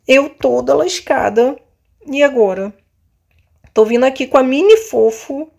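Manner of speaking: normal talking voice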